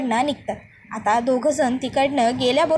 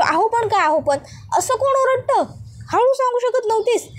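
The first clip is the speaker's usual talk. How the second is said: much higher-pitched acted reproach; exasperated scolding; 9 semitones higher than usual. A narrator's reading. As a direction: cut off her excuse in exasperation. He cuts off her excuse, scolding.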